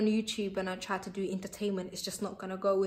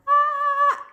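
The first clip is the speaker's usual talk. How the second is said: a sudden high, loud squeal; mock YouTuber squeal; 11 dB louder than usual. Vlogger mocking YouTubers with a high loud squeal. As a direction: squeal like an over-the-top YouTuber.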